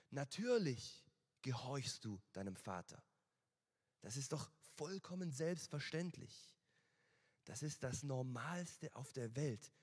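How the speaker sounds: usual speaking voice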